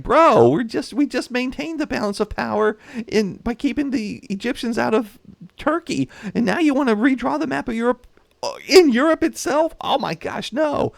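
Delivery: a high, fed-up plea to a reckless friend; exasperated Austria. Retold or imitated. Austria scolding France over the map, in a high exasperated mock whine. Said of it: "Austria's looking at France like".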